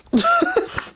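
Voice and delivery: a short, high, delighted squeal of laughter. Real, not acted. Reader laughing at her own joke, high squeal.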